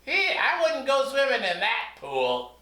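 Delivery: high squeaky banana puppet; Mister Bananas squeaks back to the teacher. Acted. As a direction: play a chirpy fruit puppet. Puppet jokes about the pool.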